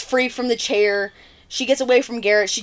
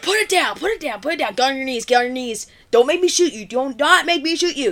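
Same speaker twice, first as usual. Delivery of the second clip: rapid repeated commands, slightly raised pitch; mock tough guy. Retelling a boy with a shotgun threatening Ricky, mock tough. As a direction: act a wannabe badass giving orders. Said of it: "just trying to be badass".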